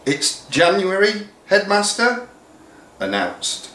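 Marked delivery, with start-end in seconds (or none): 0.1-2.3 gently correcting. Acted. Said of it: "corrected his secretary".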